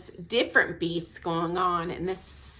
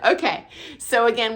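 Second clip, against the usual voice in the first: embarrassed laugh; 2 semitones higher, 4 dB louder than usual. Real, not acted. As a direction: laugh it off and carry on. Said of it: "don't laugh at me".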